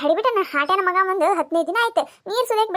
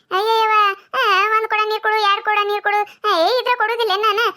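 ordinary delivery

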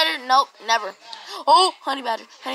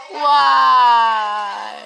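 exasperated cry; 6 dB louder than usual. Real, not acted. Can't get the acorn.

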